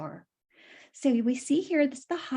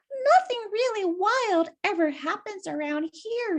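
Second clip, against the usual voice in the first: Winnie's voice; 7 semitones higher than usual. Acted. Winnie voiced in read story.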